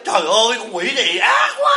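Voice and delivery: high, mock-horrified cry at the mirror; hammed-up shriek of fright at your own face; mock horror. Monk mocking a person shocked by their own reflection, hammed-up shriek.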